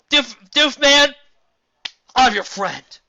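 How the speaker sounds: high, loud cartoon voice; big, drawn-out cartoon declaration; sidekick character voice. A narrator's reading. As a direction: call it out slowly and loudly. Sidekick answers the lonely scientist.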